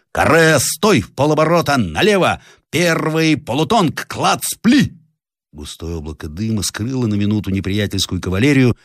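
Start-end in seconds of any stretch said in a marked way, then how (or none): 0.1-4.9 shouted commands. Acted. Captain to his soldiers, shouted high firing orders. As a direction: bark the drill commands at the square.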